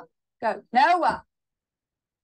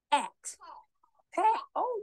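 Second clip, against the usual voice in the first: ordinary delivery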